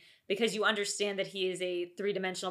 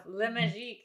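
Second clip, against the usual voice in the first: mock French. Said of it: "the French version of the Harry Potter stuff".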